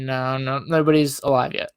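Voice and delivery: ordinary talk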